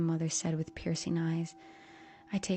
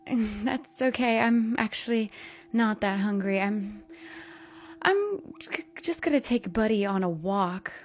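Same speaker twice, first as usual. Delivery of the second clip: nervous excuse; 4 semitones higher, 4 dB louder than usual. A narrator's reading. Nervous daughter excusing herself.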